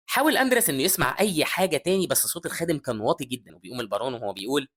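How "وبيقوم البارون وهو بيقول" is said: ordinary delivery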